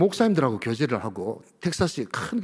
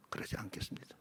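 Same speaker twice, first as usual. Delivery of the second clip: whispered vow; 20 dB quieter than usual. Acted. Quiet resolve in sermon.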